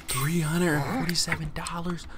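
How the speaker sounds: ordinary talk, nothing marked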